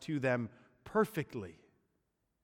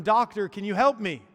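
loud, high desperate call from the hole; crying out for help; 8 semitones higher, 10 dB louder than usual. Acted. Man stuck in a hole calls to passing doctor, loud and pleading. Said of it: "he cried out to him".

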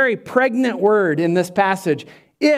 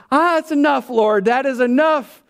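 higher, playful cry of too much; a touch of mock overflow; mock overwhelmed; 5 semitones higher than usual. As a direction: laughingly beg for it to stop. Overwhelmed by God's outpouring.

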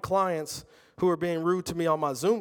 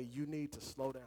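hushed echo; 3 semitones lower, 16 dB quieter than usual. Retold or imitated. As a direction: repeat it much softer, almost to yourself. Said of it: "And then I heard him say".

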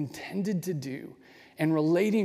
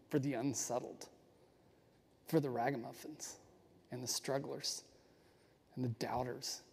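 softer and softer with each phrase; softening; 3 semitones lower, 9 dB quieter than usual. Real, not acted. Teacher quietly naming who the blessing is for, softening. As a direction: let the list fade down gently.

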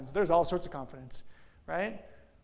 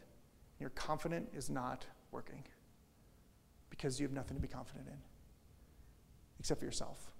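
much softer, near whisper; hushed; 2 semitones lower, 10 dB quieter than usual. Real, not acted. Pastor confiding to the congregation about failing confidence, hushed. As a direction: draw them in, intimate.

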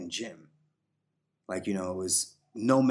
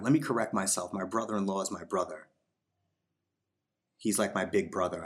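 ordinary talk

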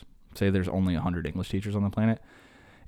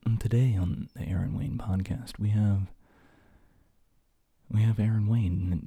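slow, mannered radio-host voice; mock NPR host. Mocking NPR hosts while ranting about podcasts, slow mannered radio voice. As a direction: put on a lightly smoothed public radio announcer. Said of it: "how about the N P R people".

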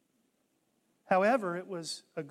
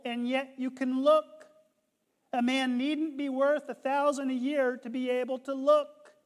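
rising preacher's cry; 4 semitones higher, 6 dB louder than usual. Retold or imitated. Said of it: "The preacher began thus".

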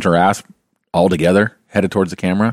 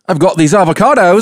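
high, loud New Zealand dealer pitch; shady street dealer; 10 semitones higher, 5 dB louder than usual. Pretend. Avocado thief lures someone into an alley, high and loud. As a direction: hiss the offer like a back-alley dealer. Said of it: "they're like".

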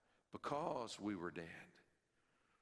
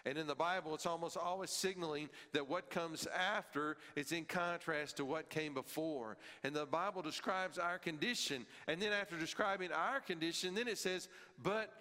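higher, louder building sermon; impassioned preaching; 8 semitones higher, 3 dB louder than usual. Acted. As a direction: rise toward the contrast.

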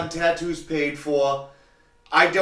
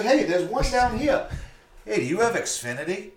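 imitating the customer; 7 semitones higher than usual.

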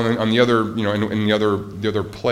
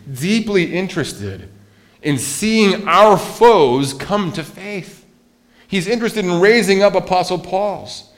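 higher, louder and slowing, building preacher's cry; full-force preaching; 8 semitones higher, 5 dB louder than usual. Acted. Pastor urging the church about foes, preaching full force. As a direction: drive it home to the church.

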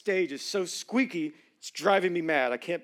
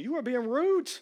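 higher scolding parent voice; 8 semitones higher than usual. Retold or imitated. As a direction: tell off a child. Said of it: "That's what we tell our children".

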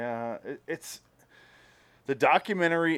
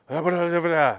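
mock German babble, a bit higher and louder; fake German gibberish; 5 semitones higher, 5 dB louder than usual. Retelling Brando's fake German, babbled nonsense. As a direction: rattle off nonsense that sounds German. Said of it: "he's saying this fake German stuff".

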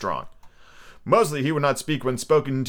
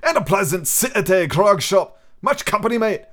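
put-on higher, louder old seaman voice; old sea captain; 8 semitones higher, 3 dB louder than usual. Old captain praising the cove, gruff and loud. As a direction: play the gruff old buccaneer. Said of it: "high, old, tottering voice".

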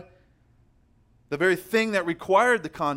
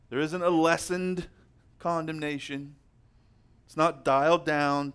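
normal talking voice

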